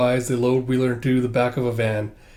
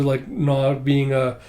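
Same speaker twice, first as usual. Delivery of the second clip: usual speaking voice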